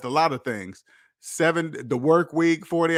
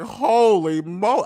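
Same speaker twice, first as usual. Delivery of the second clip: slow, stunned half-swear, a little louder; amazed; 5 semitones higher, 4 dB louder, much slower than usual. Retold or imitated. Retelling meeting the German couple, mild amazed gasp. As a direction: trail off mid-word in awe. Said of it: "I was just amazed".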